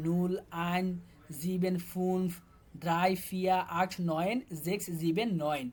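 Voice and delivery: German numbers said slowly one by one; teacher showing how the recording will say the numbers, slowly; slow counting demo. Shown as a demonstration. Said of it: "যদি এ এরগুলা বলে".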